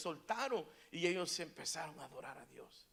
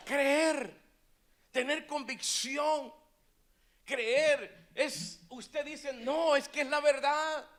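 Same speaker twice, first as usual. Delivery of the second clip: loud, high preacher's proclamation; rally the congregation with raised voice; full-force preaching; 7 semitones higher, 12 dB louder than usual. Acted. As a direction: belt out the key words to the crowd.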